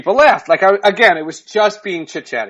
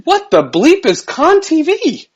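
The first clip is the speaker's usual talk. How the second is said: baffled; 12 semitones higher, 3 dB louder than usual. Retold or imitated. Retold baffled question.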